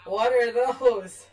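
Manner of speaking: slow, loud startled question; alarmed at what just appeared; surprised. Real, not acted. Sudden bigger crabs appear.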